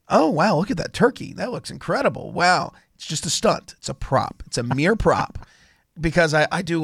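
ordinary talk

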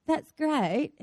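soft, polite non-reaction; quietly acted lack of interest; unimpressed husband. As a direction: imitate a husband mildly humouring someone. Husband's mild reply, repeated.

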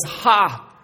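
a sharp loud demon cry; sudden shouted outburst. Acted. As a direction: bark it out like a possessed man. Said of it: "he shouts at Jesus".

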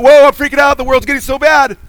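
high, loud panicked whoa; mock freaking out. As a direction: play the panicking believer, over the top. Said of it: "It's not".

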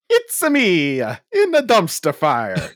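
high, loud, drawn-out Mario voice; Mario impression. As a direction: belt it out slowly as an excitable Mario. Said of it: "Hey, call him Chris Pratt".

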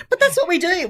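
a hint of a high, wounded chiropractor; pleading it back, lightly voiced; defensive chiropractor. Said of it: "And she's like".

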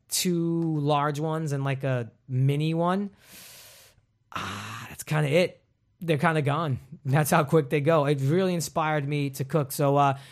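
normal talking voice

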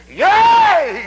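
very high, loud whoop of YEA; overtaken cry. Acted. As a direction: burst out as if you just have to say it. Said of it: "overtaken by the power of God".

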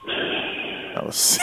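breathy, drawn-out sighing words; long sigh. Acted. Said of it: "A long sigh".

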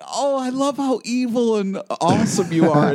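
high-pitched, gushing fan reaction; retold gushing admiration. Fan recounting love for an evil character, gushing. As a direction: gush over a favourite villain.

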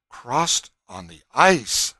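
slow, loud incredulous echo; astonished. A narrator's reading. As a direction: repeat it slowly in disbelief, stretching the words. Everyone present echoes her in disbelief.

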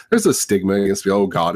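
usual speaking voice

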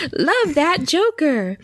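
slow, high, savoring each word; drawn-out. Real, not acted. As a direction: stretch it out fondly. Slow drawn-out affectionate line.

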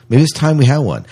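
usual speaking voice

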